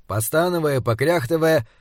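ordinary delivery, nothing marked

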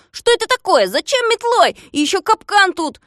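high-pitched, loud outraged protest; loud, squawking indignation; indignant. A narrator's reading. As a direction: protest in disbelief at being attacked. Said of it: "возмутился Незнайка".

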